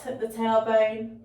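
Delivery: ordinary delivery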